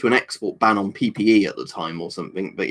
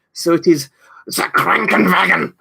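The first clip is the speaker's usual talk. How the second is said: Krankenwagen barked higher and louder in a mock German accent; angry German voice; 7 semitones higher, 4 dB louder than usual. Shown as a demonstration. Reading the translator's word to chat, hammed-up angry German. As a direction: spit out Krankenwagen like a furious German. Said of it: "try and pronounce it in an angry German voice".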